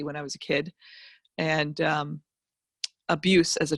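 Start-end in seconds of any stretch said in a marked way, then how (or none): none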